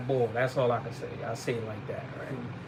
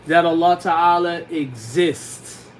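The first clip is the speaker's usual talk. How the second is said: slow translation; 4 dB louder, much slower than usual. Shown as a demonstration. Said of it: "You have to know this".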